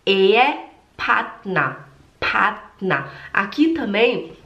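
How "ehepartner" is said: Ehepartner slowly, with a long E in Ehe; careful slow model of Ehepartner. Shown as a demonstration. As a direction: draw out Ehe, then partner ending in a light A. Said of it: "É um E longo e no final é um E".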